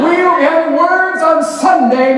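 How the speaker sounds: booming pastor threat. Retold or imitated. Pastor playing the parent threatening the teacher, booming. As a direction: thunder it from the pulpit.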